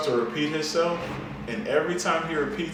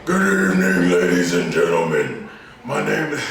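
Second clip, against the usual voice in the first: mad, louder and lower; 6 semitones lower, 8 dB louder than usual. Retold or imitated. Beggar angry at riders for no money, loud and low. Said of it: "like he's getting mad at you".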